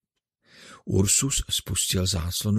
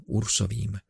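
usual speaking voice

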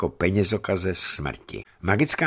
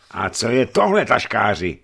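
raised, louder challenge; calling out; 3 semitones higher, 6 dB louder than usual. A narrator's reading. Hunchback showing coins to the accused merchant, calling out. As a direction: cry out the accusation.

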